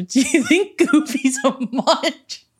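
slow giggling question; laughing. Retold or imitated. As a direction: ask it while laughing. Retold joking question, laughing.

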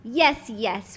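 normal talking voice

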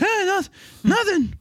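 put-on high nervous kid caught out; high flustered Buster. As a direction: squeak out a guilty denial. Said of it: "He's like".

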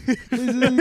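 begging through laughter; laughing plea. Real, not acted. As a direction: plead while laughing.

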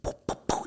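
soft, voiceless little pops; imitated popping. Man retelling what he felt in his neck, imitated popping. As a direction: puff out a string of quiet pops. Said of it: "I just felt".

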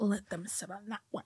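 very soft, whispery scheming aside; mock scheming aside. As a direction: imitate a schemer plotting under her breath. Voicing the scheming mother-in-law.

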